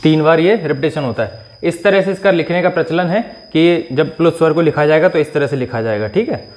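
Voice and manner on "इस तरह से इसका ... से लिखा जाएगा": ordinary talk, nothing marked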